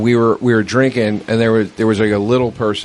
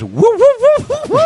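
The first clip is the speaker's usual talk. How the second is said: shrill loud woo woo whistle; high whistling woo; 6 dB louder than usual. Retold or imitated. Friend whistling on the phone, loud high woo woo. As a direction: blow a party whistle with your voice. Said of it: "I just hear a whir whistle".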